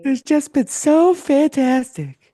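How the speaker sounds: very high, loud, drawn-out mock gush; fawning reporter. Sycophant reporter praising the president, exaggerated fawning. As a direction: squeal it slowly in parody of adoration. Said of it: "new media in there that say".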